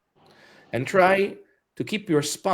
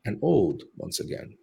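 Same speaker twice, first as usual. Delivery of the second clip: guided meditation; 2 semitones lower, 5 dB quieter than usual. Acted. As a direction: guide them calmly through the breath. Leads breathing meditation.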